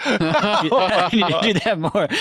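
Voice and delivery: laughing through the words, slowly; words broken by real laughter. Real, not acted. Laughing at the retold quote.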